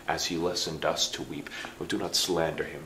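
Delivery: usual speaking voice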